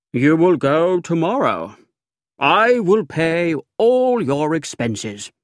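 eager offer. A narrator's reading. Narrator eagerly packs his secretary off to Florence. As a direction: sweep him off generously. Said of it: "I cried".

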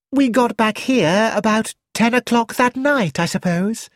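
put-on high, airy young woman, carelessly chatty; Rosamond. A narrator's reading. Rosamond recounts her day.

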